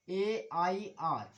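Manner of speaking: very slow letter by letter; slow spelling out. Shown as a demonstration. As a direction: spell each letter out, slowly. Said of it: "जैसे कि हमने लिखा".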